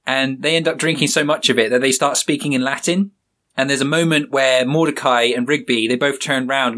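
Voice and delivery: ordinary talk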